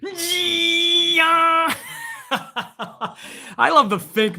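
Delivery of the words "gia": high, drawn-out ring announcer bellow; Justin Roberts impression. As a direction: belt it out like an arena announcer. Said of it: "Isn't it like that?".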